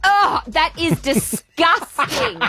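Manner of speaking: high, slow, drawn-out mock horror; imitating disgust. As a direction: stretch out the disgust like a snob. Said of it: "And they go".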